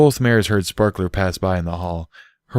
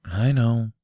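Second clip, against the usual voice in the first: strained whisper; 4 semitones higher, 3 dB quieter than usual. Acted. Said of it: "Bucky replied in a strained whisper".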